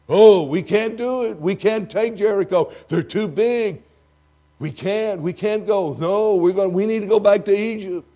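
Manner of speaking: high, loud, whining complaint; mock the panicky crowd begging to turn back; whiny fearful crowd. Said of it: "what the faithless majority said".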